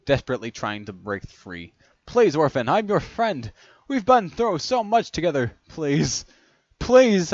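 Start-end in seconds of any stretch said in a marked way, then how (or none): 2.1-7.3 high, loud begging; frantic plea, rising to a yell; desperate pleading. A narrator's reading. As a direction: beg a friend for your life. Captive pleads desperately for his life.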